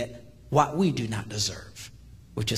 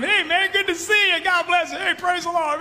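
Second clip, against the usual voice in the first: much higher, chirpy cheerful greetings; church folk greeting; 14 semitones higher, 5 dB louder than usual. Retold or imitated. Congregants hugging the hitman, high cheerful greeting. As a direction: gush like happy churchgoers hugging a stranger.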